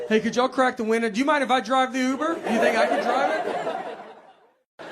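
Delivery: high, strained pleading, slowing at the end; hammed-up rising panic in a crowded Uber; mock panic. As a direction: beg like the walls are closing in. Escalating claustrophobic panic.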